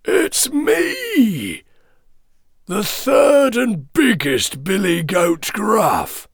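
high, hoarse shouted goat voice; big hoarse goat. A narrator's reading. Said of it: "in his big and hoarse voice".

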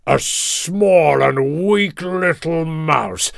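slow, mocking lion growl; scornful King Lion. A narrator's reading. Lion ridiculing the weak little mouse, scornful growl. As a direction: scoff grandly in a big lion voice. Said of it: "King Lion laughed".

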